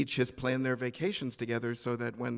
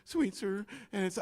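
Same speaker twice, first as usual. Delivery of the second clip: panicked repeat; 5 semitones higher, 4 dB quieter than usual. Retold or imitated. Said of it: "and I'm panicked".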